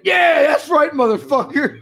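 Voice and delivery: high, loud gloating cheer; mock triumphant yell. New foreman relishing bossing his worker, triumphant yell. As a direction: crow it like a boss on a power trip.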